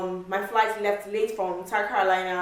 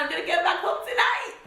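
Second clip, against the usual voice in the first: excited; 10 semitones higher than usual. Retold or imitated. Excited about getting home.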